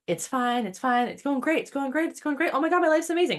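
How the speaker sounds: rising excitement up the first hill; building mock giddiness.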